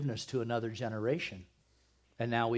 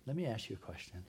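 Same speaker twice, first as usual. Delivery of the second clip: quiet repeat; 3 semitones lower, 9 dB quieter, faster than usual. Real, not acted. Preacher repeats question to congregation.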